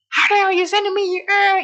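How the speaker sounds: high, loud mock outrage; hammed-up yell at the desk; angry outburst. Angry version she rejects.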